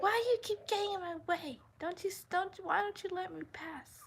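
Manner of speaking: high, fast put-on old lady voice; mock old lady. Imitating the old lady who argued in the aisle, high and fussy. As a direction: squawk like a cranky old woman. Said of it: "she's like".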